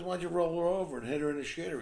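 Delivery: high, loud, quick suggestion; pushy concierge. Acted. Concierge pushing a new groom with crude advice, loudly insistent. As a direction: urge him on, quick and loud.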